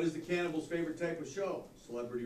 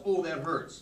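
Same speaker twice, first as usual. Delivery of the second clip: ordinary delivery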